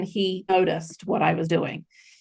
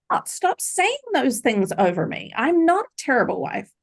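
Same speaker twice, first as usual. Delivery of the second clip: retold protest; 7 semitones higher than usual. Pushing back at the abusive husband.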